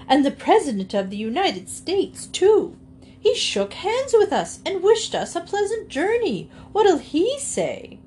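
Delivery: high-pitched, scandalized lady; exclaiming Missus Wiggins. A narrator's reading. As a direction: pile on the indignation in a high voice. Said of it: "exclaimed Missus Wiggins".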